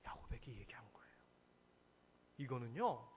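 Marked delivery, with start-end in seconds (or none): 0.1-1.2 whisper. Real, not acted. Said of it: "whispered aside".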